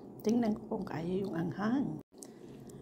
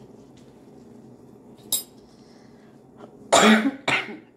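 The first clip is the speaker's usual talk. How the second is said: loud choking cough from chili; hard coughing after spicy bite; coughing fit; 4 semitones higher, 15 dB louder than usual. Real, not acted. Chili burning her throat.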